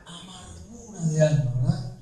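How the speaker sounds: "Amargura de alma" said very soft and low; hushed. Shown as a demonstration. Preacher answering his own question, "Amargura de alma" hushed and low. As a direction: let "Amargura de alma" sink into a hush. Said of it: "¿cómo estaba su alma?".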